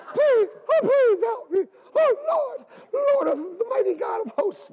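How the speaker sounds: hammed-up, very high wailing plea; mock dramatic prayer. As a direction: overact a weeping prayer. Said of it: "I know, you go like this".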